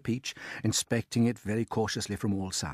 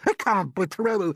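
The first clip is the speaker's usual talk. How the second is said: a much higher, louder put-on aunt's voice, excited; Aunt Sponge; 9 semitones higher, 6 dB louder than usual. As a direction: play the greedy aunt gaping at the peach.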